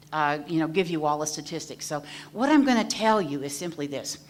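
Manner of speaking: normal talking voice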